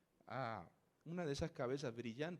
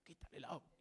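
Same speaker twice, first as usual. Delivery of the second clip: softer, insistent whisper; urgent whisper; 10 dB quieter, much faster than usual. Retold or imitated. Pressing a companion to stop the reader, soft whisper. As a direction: whisper the order secretly.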